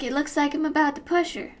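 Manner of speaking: ordinary talk, nothing marked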